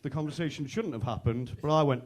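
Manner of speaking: usual speaking voice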